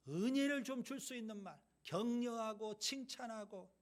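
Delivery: normal talking voice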